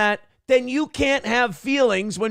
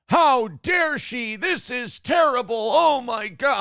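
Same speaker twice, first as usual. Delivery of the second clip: slow, higher, clutching-pearls outrage; mock outrage; 6 semitones higher, slower than usual. Mocking conservative Twitter reacting to the joke, slow mock outrage. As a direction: drag out the fake horror. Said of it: "conservative Twitter accounts posting it".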